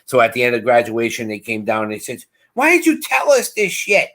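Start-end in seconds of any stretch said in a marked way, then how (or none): 2.5-4.1 imitating mom, pitched much higher; upset mom. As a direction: voice the mom's reproach, high. Mom confronting son after graduation.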